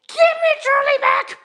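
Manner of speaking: shrieking falsetto shout; mock wail. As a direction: scream it like a ghost. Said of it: "even creepier".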